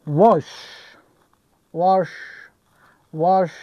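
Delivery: "wash" with a rounded back aw vowel. Shown as a demonstration. Teacher giving an example of the آ sound, "wash" with back vowel. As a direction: model "wash" as a separate word, drawn out.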